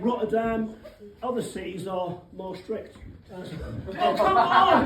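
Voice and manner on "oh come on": a loud, high cry of disbelief; exasperated outcry. Real, not acted. Comedian cries out in disbelief when his joke misses. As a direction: throw up your hands at the crowd.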